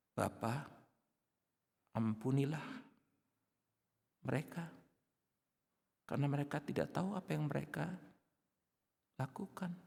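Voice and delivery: forgive them gently, almost hushed; Jesus quietly asking the Father to forgive; tender plea. Acted. Jesus forgiving his tormentors.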